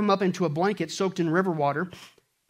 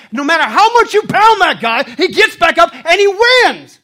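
loud, high, slowed proclamation; rousing shout; 12 semitones higher, 16 dB louder than usual. Acted. Preacher telling the church the Rocky fighter always wins, rousing shout. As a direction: proclaim it like a victory.

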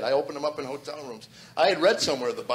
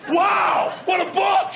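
very high, loud, joyful cries; ecstatic shouting; 8 dB louder, slower than usual. Retold or imitated. Man on lawn waving Bible at neighbours: ecstatic shout. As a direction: yell it to the whole street. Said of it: "run on my lawn and hold the Bible up".

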